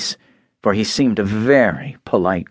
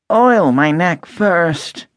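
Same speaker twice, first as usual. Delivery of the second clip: higher, slow rusted voice; stiff Tin Man, slow; 7 semitones higher, 3 dB louder, much slower than usual. A narrator's reading. Tin Man begging Dorothy for oil, slow and higher. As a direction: ask slowly as if every joint is stuck.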